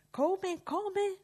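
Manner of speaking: high outraged shriek. Acted. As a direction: squeal it in disbelief. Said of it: "strillò lui".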